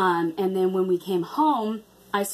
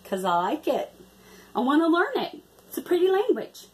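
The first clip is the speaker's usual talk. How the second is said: mock defensive reply; 6 semitones higher than usual. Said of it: "And I'd be, just be like".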